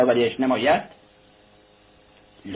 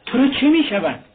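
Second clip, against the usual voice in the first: high, loud indignant shout; 12 semitones higher, 4 dB louder than usual. A narrator's reading. Razumikhin shouting at Luzhin, high and loud. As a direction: yell the question at him. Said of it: "رازومیخین فریاد زد".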